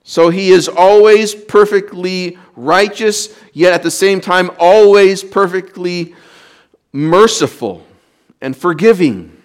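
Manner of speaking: high, loud proclaiming from the pulpit; ringing sermon declaration; full-force preaching. Acted. Preacher proclaims God's righteousness.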